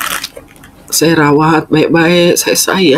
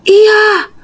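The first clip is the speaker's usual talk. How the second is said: mother's yes. Retold or imitated. Mother confirms.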